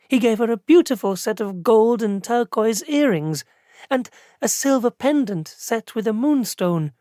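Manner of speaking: put-on young clerk fondly listing her friend's gifts; lightly voiced young clerk. A narrator's reading.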